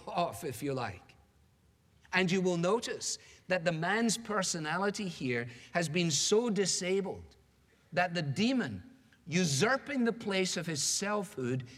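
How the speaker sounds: normal talking voice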